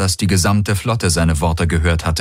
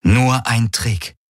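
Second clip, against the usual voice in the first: ordinary delivery, nothing marked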